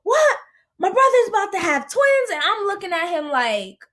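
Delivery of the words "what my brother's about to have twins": high-pitched delighted disbelief; imitated excitement. Husband hearing of brother's twins, imitated excitement. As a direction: burst out high at the news. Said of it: "He's excited".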